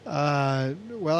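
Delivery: ordinary delivery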